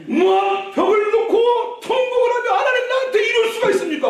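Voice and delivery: high, loud, sing-song wailing complaint; wailing protest. Acted. Hezekiah argues with God at the wall, loud wailing protest. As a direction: wail and argue with God in mock anguish. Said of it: "하고 막 따지는 거예요".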